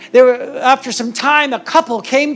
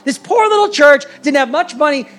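higher, louder preaching build; 7 semitones higher, 4 dB louder than usual. Acted. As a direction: drive the stakes home from the pulpit. Preaches the dilemma.

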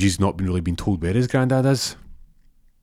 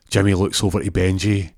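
normal talking voice

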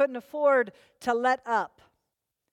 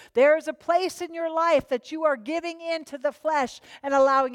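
louder, higher, driving sermon delivery; full-force preaching; 4 semitones higher, 5 dB louder than usual. Acted. Pastor urging the congregation to follow the Spirit, full-force preaching. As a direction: drive every line home to the congregation.

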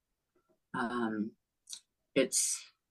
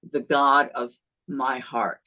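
ordinary delivery

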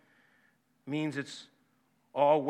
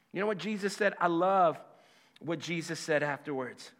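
ordinary talk

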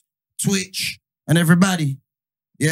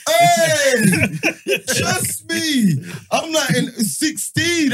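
high laughing exclamation; 10 semitones higher, much slower than usual. Real, not acted. Laughing at fake youths.

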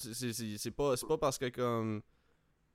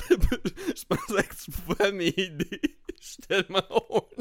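high voice broken by giggles; laughing; 8 semitones higher, 7 dB louder than usual. Retold or imitated. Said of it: "il est comme".

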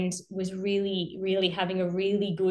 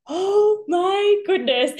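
slow, high, louder amazed gasp; 11 semitones higher, 6 dB louder, much slower than usual. Retold or imitated. Mum watching her baby relax, slow and amazed. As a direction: stretch it out in wonder.

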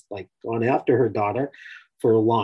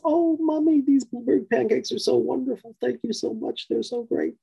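put-on high sweet little girl voice, much higher; child's voice; 13 semitones higher than usual. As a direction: gush like an eight-year-old girl. Daughter voiced in retold story.

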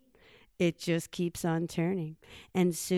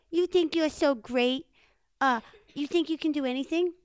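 higher, louder little-critter voice; challenging Chipmunk; 7 semitones higher, 7 dB louder than usual. Acted. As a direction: needle the big guy in a quick high voice.

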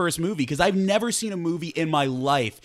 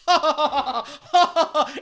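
loud, high fake guffaw; mock group laughter; 12 semitones higher, 5 dB louder than usual. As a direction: imitate a crowd's forced laughter, hammed up. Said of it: "everybody's like".

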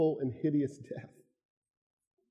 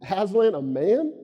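stern beaver; 5 semitones higher, 5 dB louder, slower than usual. A narrator's reading. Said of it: "said Mister Beaver sternly".

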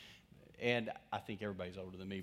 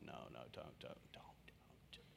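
hushed, slow, pleading don't in a whisper; urgent whisper; 10 semitones lower, 20 dB quieter, slower than usual. Retold or imitated. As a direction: whisper like a wife begging him to stop. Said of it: "I bet Annette's going".

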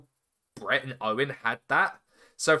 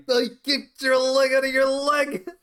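higher, louder mock wrestler yell; hammed-up promo line, a touch of a yell; Owen Hart quote; 9 semitones higher, 5 dB louder than usual. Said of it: "Even when he would say".

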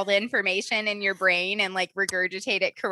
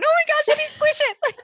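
much higher, louder gushing squeal; puppy squeal; 6 dB louder than usual. Retold or imitated. Adult spotting a puppy on the street, high gushing squeal. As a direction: squeal like you can't resist a puppy.

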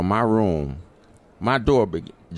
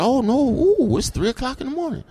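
high, fast flustered protest; high-pitched, hurried objection. Retold or imitated. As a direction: squeak out a flustered excuse. Protesting at 3am.

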